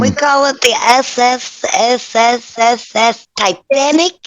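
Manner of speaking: hissing S S S S stretched long. Pretend. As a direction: stretch every S like a hiss. Drawn-out SSS in ship name.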